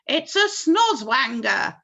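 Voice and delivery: slow, loud cry; police chief crying out; alarmed cry. A narrator's reading. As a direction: announce the monster in alarm. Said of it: "cried the chief of police".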